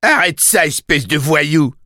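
strangely high, scandalised cry; high-pitched outrage. A narrator's reading. Taxi driver scolding the boy who hits his car, high-pitched outrage. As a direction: squawk it high and indignant. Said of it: "d'une voix étrangement haut perché".

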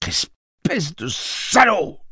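insulte haute et lente, rageuse; sifflé. Acted. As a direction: crache l'insulte lentement. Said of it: "siffla-t-il". (Eddie insulte Roland, sifflant)

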